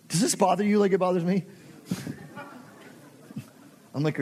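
normal talking voice

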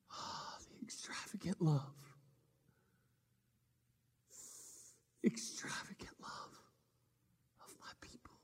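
breathy, hushed whisper, near tears; tearful whisper. Acted. Jesus on the cross whispering to himself, tearful whisper. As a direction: whisper it like a last awed breath.

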